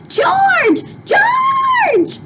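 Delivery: very high frantic calling; mother screaming for her husband in a panic; frantic cry. Acted. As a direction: scream for George down the hall. Mother screams, read aloud.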